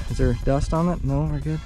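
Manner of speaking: normal talking voice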